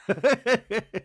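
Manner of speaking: high giggle; tickled giggling at own bit; laugh. Real, not acted. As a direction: break into a high-pitched laugh. Laughs after own mock outburst.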